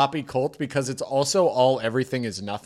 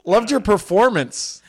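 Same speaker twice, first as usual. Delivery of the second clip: louder, higher, amused imitation of a fan; mock departing fan; 4 semitones higher, 5 dB louder than usual. Fan excusing himself after the show, mock apologetic gushing. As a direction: gush an apology through a laugh.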